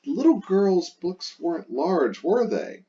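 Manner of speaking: high coaxing tag question; leading question. Shown as a demonstration. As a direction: lead them to answer no. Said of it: "So I want the answer to be no".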